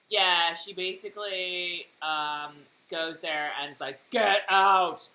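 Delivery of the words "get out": loud, drawn-out order; mock outburst. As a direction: throw them out, emphatically. Retold outburst ordering someone out.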